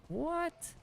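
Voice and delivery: high-pitched startled question, rising sharply; taken aback mid-game, voice shooting up; real surprise. Real, not acted. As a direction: let the word leap up in disbelief.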